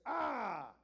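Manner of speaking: loud demonic ah; demon cry. Acted. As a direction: cry out like a spirit.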